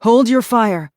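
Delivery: high, loud command; shouted command. Acted. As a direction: shout to stop the shooting.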